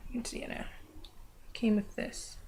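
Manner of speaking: slow, secretive whispered reveal. Real, not acted.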